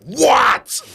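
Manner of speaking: very high, loud exasperated yell; loud fed-up shout at a nagging toddler; snapping yell. Retold or imitated. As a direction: snap back at the kid, fed up. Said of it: "He yelled at you".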